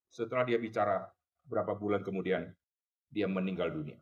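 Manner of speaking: normal talking voice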